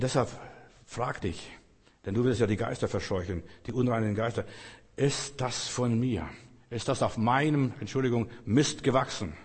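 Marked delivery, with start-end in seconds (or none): none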